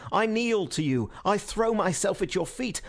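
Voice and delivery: high, fervent, pained entreaty; martyr's plea. A narrator's reading. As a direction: implore them as if beyond pain. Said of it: "a martyr who in an ecstasy of pain".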